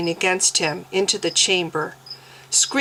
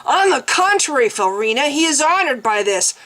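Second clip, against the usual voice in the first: taunting; 7 semitones higher, 3 dB louder than usual. A narrator's reading. Mens taunting Felrina.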